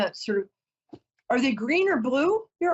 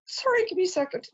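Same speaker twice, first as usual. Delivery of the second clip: choked up, asking for a moment; fighting back tears; 8 semitones higher, faster than usual. Real, not acted. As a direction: voice climbing high as tears come. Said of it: "I don't wanna cry".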